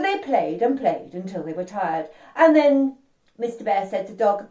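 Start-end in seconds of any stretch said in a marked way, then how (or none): none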